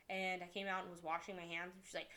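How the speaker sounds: normal talking voice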